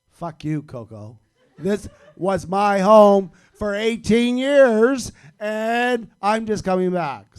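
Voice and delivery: furious shout at the dog, slowing down; angry rant. Retold or imitated. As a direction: yell it at the little dog, half laughing. Ranting at the dog in thought.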